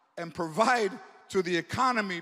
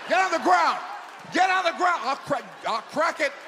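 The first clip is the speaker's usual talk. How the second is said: barked cop yell; cop shout; 8 semitones higher, 5 dB louder than usual. Acted. Cop yelling at a fleeing suspect, shouting. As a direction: shout it like a cop making an arrest.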